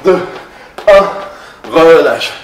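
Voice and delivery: shouted count and release; loud call. Real, not acted. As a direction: call it out to the class. Coach calls end of interval.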